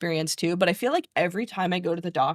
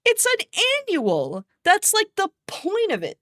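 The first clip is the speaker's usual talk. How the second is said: exasperated; 12 semitones higher than usual. Real, not acted. Exasperated explaining annual checkup.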